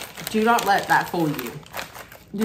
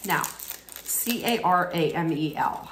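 normal talking voice

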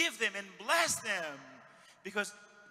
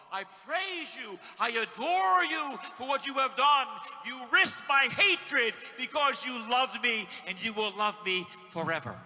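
loud, slow praise to God; preacher leading praise to the Lord; fervent prayer; 3 semitones higher, 9 dB louder than usual. Acted. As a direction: pray it out with all your heart.

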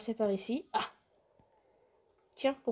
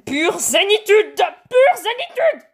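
high, drawn-out screamed mantra; shouting; 10 semitones higher, 12 dB louder, slower than usual. Real, not acted. Player insisting she is totally zen, comically screaming. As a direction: scream it slowly, higher the second time. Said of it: "Désolée si je crie".

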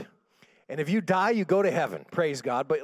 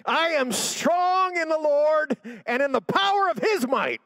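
high, loud, drawn-out cry; defiant shouting; 10 semitones higher, 5 dB louder than usual. Acted. Sister told to declare it after throwing up, defiant shout. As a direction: shout the declaration with all you have.

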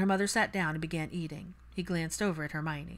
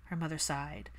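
plain reading voice

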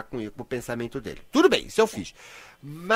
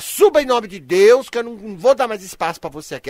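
commanding banishment; 5 semitones higher, 10 dB louder than usual. Acted. Host telling listener's fear to leave, loud command. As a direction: cast it out like an exorcist. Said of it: "Você chega".

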